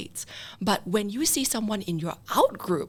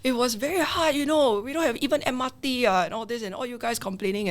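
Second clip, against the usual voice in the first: higher-pitched mock old-timer grumbling; complaining older worker; 5 semitones higher than usual. Older worker lecturing the younger generation, mock complaining. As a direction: play an elder moaning about hardship. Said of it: "older people in the workforce be like".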